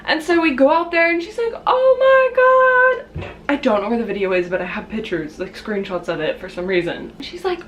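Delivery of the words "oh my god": shocked. Retold or imitated. Mom sees the fallen fridge.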